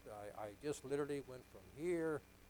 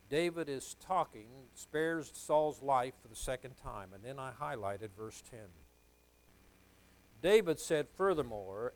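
loud, emphatic sermon delivery; forceful preaching; 9 dB louder than usual. Real, not acted. Preacher reading a startling verse to his congregation, forceful preaching. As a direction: preach it out, punching key words loudly.

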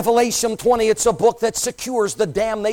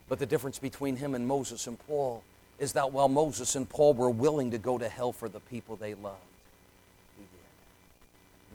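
very soft, low, nearly breathed at the end; reverent hush; 9 semitones lower, 12 dB quieter than usual. Acted. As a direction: lower your voice for the revelation. Hushed reverent climax.

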